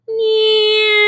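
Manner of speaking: high zoom that drops off; car passing sound. Shown as a demonstration. Teacher repeats the car zoom for students, high falling zoom. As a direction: imitate a car flying past, pitch falling. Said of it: "Did you hear the sound actually change?".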